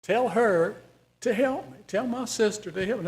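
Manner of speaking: insist, pushing on help; Martha's demand again. Acted. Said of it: "how do you think Jesus took that".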